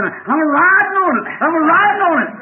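full-force high holler; hollering and screaming. Retold or imitated. Preacher acting a man riding a log over ripples, hollering. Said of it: "just a hollering and a screaming".

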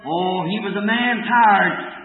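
ordinary talk, nothing marked

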